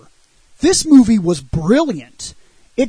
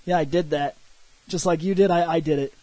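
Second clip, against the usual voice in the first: quiet, low, smug mock brag; brushing it off like it was easy, softly; show-off brag; 6 semitones lower, 7 dB quieter, faster than usual. Fighter to master, quiet show-off brag. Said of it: "kinda shows off like".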